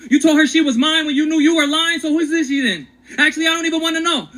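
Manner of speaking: rising, heated accusation at the wife; angry confrontation. Acted. Husband confronting his wife over the lie, heated and accusing. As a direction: demand the truth, voice climbing.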